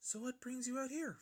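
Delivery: high, gentle pony voice; high and gentle, asking kindly; shy pony voice. A narrator's reading. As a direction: ask like a timid pony. Fluttershy voiced by narrator.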